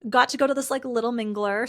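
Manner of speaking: normal talking voice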